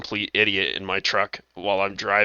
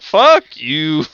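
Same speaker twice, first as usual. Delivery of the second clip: loud, higher fed-up retort; mock outburst; 5 semitones higher, 9 dB louder than usual. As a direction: snap back loudly at the reader. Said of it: "and I'm just like".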